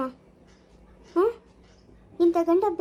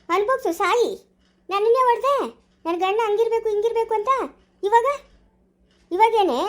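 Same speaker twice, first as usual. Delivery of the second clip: angry; 4 semitones higher, 3 dB louder than usual. Acted. Sister lashing out bitterly.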